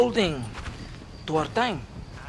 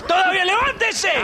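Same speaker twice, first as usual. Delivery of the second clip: high, loud scolding, fast at the end; angry shouting; 5 dB louder, faster than usual. Acted. Officer angrily shouting at his sleeping soldiers. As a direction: berate the lazy soldiers.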